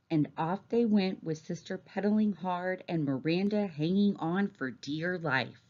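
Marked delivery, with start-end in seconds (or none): none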